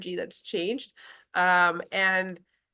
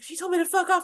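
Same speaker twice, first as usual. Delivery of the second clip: shocked mom; 11 semitones higher than usual. Retold or imitated. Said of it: "then she'll be like".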